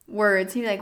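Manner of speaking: ordinary talk, nothing marked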